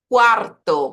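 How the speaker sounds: Quarto loud, with trilled middle R; vibrating Spanish R. Shown as a demonstration. Teacher introducing R inside a word, vibrating R. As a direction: punch Quarto, rolling the R. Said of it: "minha vibração ali também com o R".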